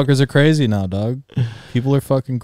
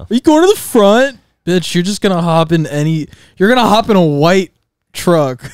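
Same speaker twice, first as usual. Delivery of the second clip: mock scolding; 6 semitones higher, 5 dB louder than usual. Friend mocking hitchhiking girls.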